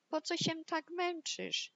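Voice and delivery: high, quickening playful voice, no real laugh; mock giggly teasing. Two pig brothers invite the builder to play, lightly giggly. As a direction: tease lightly in a high piggy voice.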